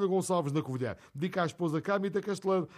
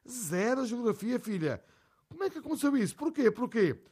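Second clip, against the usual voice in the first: higher, incredulous mother; a mother who can't believe it, pitched up; mãe espantada; 6 semitones higher than usual. Retold or imitated. Mãe espantada com a nota. (mãe incrédula, voz mais aguda)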